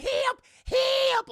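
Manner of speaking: very loud, very high mock scream; trapped girl screaming help. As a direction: shriek it from behind a stuck door. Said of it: "Just starts screaming".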